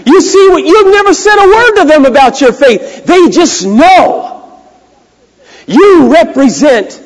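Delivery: high, loud, emphatic sermon delivery; full-force preaching. Real, not acted. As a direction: drive it home to the congregation.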